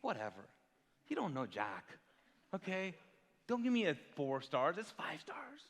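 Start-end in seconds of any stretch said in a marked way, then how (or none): 0.0-2.9 lower, softer brush-off; mock dismissive grumble at reviewers; dismissive retort. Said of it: "I'm like". 4.8-5.6 whispered aside; a quick conspiratorial whisper. Acted. Aside correcting a review rating.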